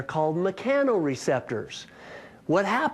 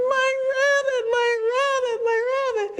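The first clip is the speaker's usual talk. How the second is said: much higher, louder, half-crying wail; imitating wailing neighbor; 3 dB louder than usual. Neighbor finds her dead rabbit on the porch, mock sobbing wail.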